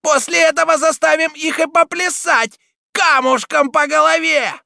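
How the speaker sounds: screeching, very high, loud taunt; Цибуля yelling. A narrator's reading. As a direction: yell the threat at full pitch. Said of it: "орала Цибуля".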